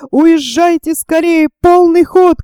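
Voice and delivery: high, loud cry to comrades; storyteller shouting an escape command; urgent shout. A narrator's reading. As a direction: call out the order at rising force. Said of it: "крикнул он".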